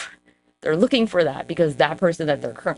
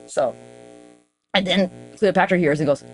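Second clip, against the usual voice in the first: normal talking voice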